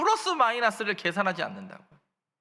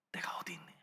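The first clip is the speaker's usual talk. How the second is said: whisper; 17 dB quieter, much faster than usual. Acted. God calls to hiding Adam.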